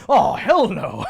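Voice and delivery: a hint of stuffy old British guy. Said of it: "that old British guy being like".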